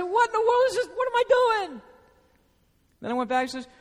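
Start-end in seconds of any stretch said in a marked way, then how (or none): 0.0-1.8 much higher, louder startled self-rebuke. Retold or imitated. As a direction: snap out of it in disbelief. Waking up to his self-pity.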